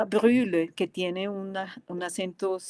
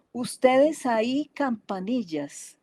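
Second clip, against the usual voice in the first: slow recitation opening high, then falling; poem reading; 5 semitones higher, 3 dB louder, slower than usual. Acted. Lecturer reading a translated Russian poem, slow recitation. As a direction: declaim it as a slow line of verse. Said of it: "lo voy a leer".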